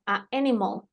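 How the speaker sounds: "A animal" broken after the first vowel; pausa entre vocales. Shown as a demonstration. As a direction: keep "A" apart from "animal". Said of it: "estas son incorrectas". ("A animal" cortado entre las dos vocales)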